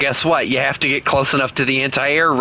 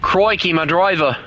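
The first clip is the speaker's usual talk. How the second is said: mock Australian exclamation; put-on Australian accent; 2 semitones higher than usual. Player losing his tank driver, put-on Australian accent. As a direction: cry out in bad Australian. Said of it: "using an Australian accent".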